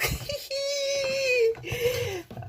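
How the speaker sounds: high laugh tipping into tears; laugh-cry with joy; laughing sob. Real, not acted. Overjoyed unboxer laugh-cries at her gift.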